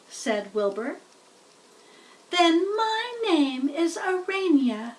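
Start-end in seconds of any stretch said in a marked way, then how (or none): 2.3-5.0 high, slow tiny voice; name herself proudly; little spider voice. A narrator's reading. First spider names herself to Wilbur, high and slow. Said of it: "said the spider".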